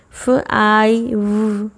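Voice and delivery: very slow फ आई व, sound by sound. Shown as a demonstration. Teacher splitting five into sounds, very slowly. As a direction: pull फ आई व apart slowly. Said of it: "long आई की sound है आई".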